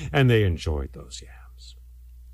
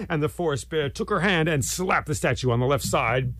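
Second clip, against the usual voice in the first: ordinary delivery, nothing marked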